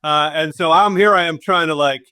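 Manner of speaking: ordinary talk, nothing marked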